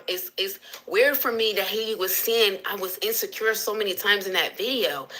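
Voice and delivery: ordinary talk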